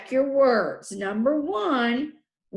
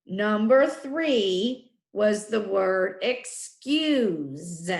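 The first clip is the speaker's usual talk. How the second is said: ordinary delivery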